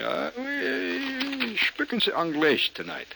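high, drawn-out Hungarian English; mock Hungarian accent. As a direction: try English as a halting Hungarian. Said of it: "Hungarian who's decided to try my English".

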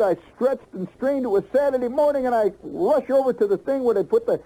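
high exultant proclamation; triumphant build. Retold or imitated. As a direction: savour the final inch in high triumph. Triumphant retold measuring morning.

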